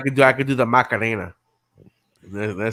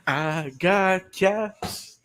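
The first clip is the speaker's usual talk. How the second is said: drawn-out joke voice; 8 semitones higher, much slower than usual. Pretend.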